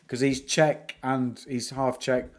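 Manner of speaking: ordinary talk, nothing marked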